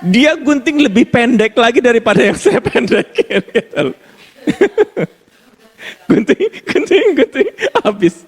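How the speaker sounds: high, slow words broken by laughter; laughing. Real, not acted. Laughing as he tells the congregation of the dog haircut. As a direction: laugh through the punchline.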